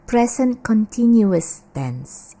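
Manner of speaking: slow CONTINUOUS with four syllables, con-TIN-yu-us; correct continuous. Shown as a demonstration. Teacher gives students an example, careful pronunciation. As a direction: stretch CONTINUOUS inside the tense name. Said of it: "yang betul adalah present continuous".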